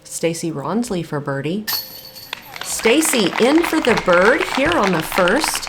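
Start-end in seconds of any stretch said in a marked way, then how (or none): 2.6-5.6 excited. Real, not acted. Live call of birdie putt.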